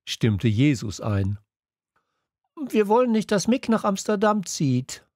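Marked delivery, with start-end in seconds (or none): 2.7-5.1 much higher pleading call. A narrator's reading. Girl calling to the headmistress, higher pleading call. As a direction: cry it out to the headmistress. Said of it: "rief Pink".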